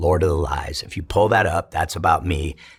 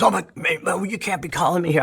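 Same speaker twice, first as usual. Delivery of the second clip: panicked high-pitched agent; 12 semitones higher than usual. Retold or imitated.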